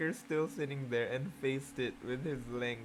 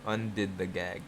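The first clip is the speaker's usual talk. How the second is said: plain reading voice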